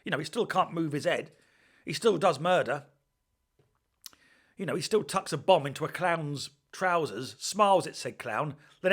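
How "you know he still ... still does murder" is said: ordinary talk, nothing marked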